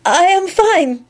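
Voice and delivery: high, drawn-out breathless reply; slow, high, shaken reply. A narrator's reading. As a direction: answer as if winded. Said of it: "Cosmina replied breathlessly".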